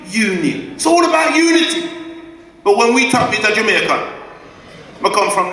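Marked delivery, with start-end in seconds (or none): 0.7-1.9 passionate shout. Real, not acted. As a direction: shout it out to rouse the hall. Speech rising to a climax.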